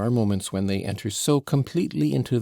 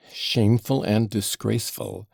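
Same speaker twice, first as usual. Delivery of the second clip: ordinary delivery, nothing marked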